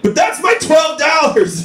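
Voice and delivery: indignant shout. Retold or imitated. Comic protests to his buddy about his twelve dollars, shouting high. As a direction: yell like your money is being stolen. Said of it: "And I was like".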